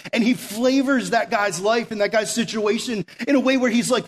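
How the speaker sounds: ordinary delivery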